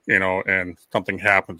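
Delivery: usual speaking voice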